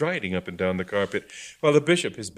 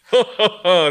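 high loud ha ha; forced laugh; 12 semitones higher, 10 dB louder than usual. Acted. Augustine laughing.

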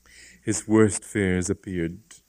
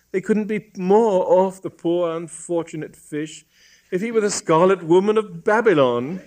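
put-on high-pitched, emphatic girl's voice; high, louder female character, complaining; despondent young woman; 11 semitones higher, 5 dB louder than usual. A narrator's reading. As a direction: protest like an upset niece. Voices the girl objecting.

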